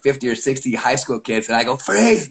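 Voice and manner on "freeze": higher called command to freeze. Retold or imitated. Coach calling out to jogging kids. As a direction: stop the whole gym at once.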